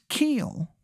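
hammed-up Maryland vowel; Shithead Steve's Maryland accent. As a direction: say it small and high with a bad Maryland accent. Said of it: "the accent is just".

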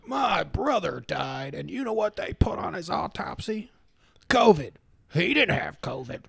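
hammed-up indignant ranter; put-on voice of a conspiracy griper; imitating aggrieved man. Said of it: "then the one guy's like".

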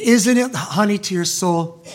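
ordinary delivery

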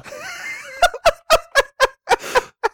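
high screaming burst of laughter; shrieking laugh. Real, not acted. Wild laugh at silly game word.

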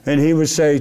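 ordinary talk, nothing marked

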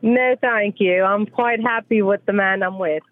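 put-on terrible British accent. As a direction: put on a hammy old Cockney British accent. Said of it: "That is a terrible British accent".

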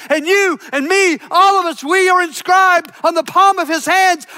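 loud, rising sermon climax; preaching at full force. Acted. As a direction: build the congregation up with passion. Preaching climax on God's hands.

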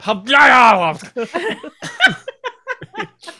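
imitated dad sneeze. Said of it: "Mine is straight up".

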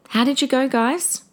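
ordinary talk, nothing marked

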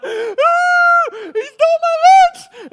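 very high, drawn-out mock wail; loud, screechy put-on tantrum cry; crying kid. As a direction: bawl it out like a child, hammed-up. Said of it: "So the kid's crying".

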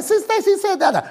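high gleeful proud dad; high excited announcing. Retold or imitated. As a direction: tell your wife in excitement. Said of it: "I said".

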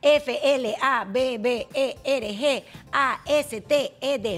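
slow letter by letter spelling; spelled out slowly. Shown as a demonstration. Host spelling the word for listeners at home, slow and deliberate. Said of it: "Para las personas que están en casa".

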